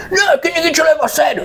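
fast, high, snippy comeback; mocking retort. Relative fires back at the narrator, fast mock snark. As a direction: fire it back quick and snide.

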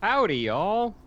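high twangy cowboy drawl; cowboy accent. Pretend. Guest greeting the hosts in a playful cowboy accent. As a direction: put on a hammy, high-pitched cowboy accent. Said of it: "in a cowboy accent".

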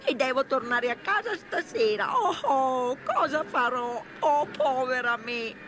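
high, loud, drawn-out wail of self-pity; tearful lament. Acted. Fake washerwoman who lost her fare money, hammed-up tearful wail. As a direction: play up the weeping, stretching the words. Toad weeps posing as poor washerwoman.

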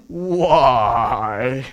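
donkey voice. Retold or imitated. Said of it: "this is the best that I can do with the donkey voice".

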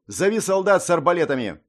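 loud, sharp battle command; commanding order. Acted. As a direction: bark the order to the soldiers. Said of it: "приказал Либра".

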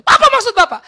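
high, loud indignant question; imitated annoyance. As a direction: mimic an upset counselee challenging the pastor. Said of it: "Hanya dia kesel sama saya". (pertanyaan kesal berteriak, menirukan)

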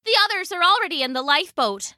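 high, rapid report over a storm; pirate woman calling urgently; shouting back. A narrator's reading. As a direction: rush it out at a high pitch. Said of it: "shouted back over the storm".

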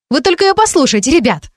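high-pitched indignant appeal; exclaiming. A narrator's reading. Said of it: "воскликнула". (возмущённо воскликнуть)

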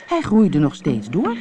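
usual speaking voice

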